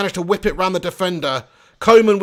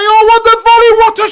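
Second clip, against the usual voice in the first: high, loud cry at a wonder goal; excited shout; 12 dB louder than usual. Real, not acted. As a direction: yell it as the ball flies in. Commentating a stunning volley.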